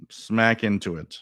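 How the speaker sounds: usual speaking voice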